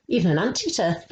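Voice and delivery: plain reading voice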